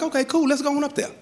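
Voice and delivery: quick, high-pitched casual reply; agreeable officer. Acted. Officer agreeing to go upstairs, quick casual high voice. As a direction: breeze through it as the easygoing cop.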